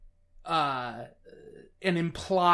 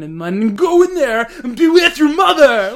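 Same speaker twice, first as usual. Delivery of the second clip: much louder, higher, hammed-up accented outburst through a laugh; mock Cage accent yelling; 8 semitones higher, 14 dB louder than usual. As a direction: imitate Cage suddenly yelling in a fake accent. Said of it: "suddenly he'd be like".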